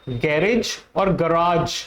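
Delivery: गैरेज as ga-rej, then गराज as ga-raazh; garage both ways. Shown as a demonstration. Teacher offering both garage sounds. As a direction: contrast गैरेज and गराज clearly. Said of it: "correct pronunciation of this word".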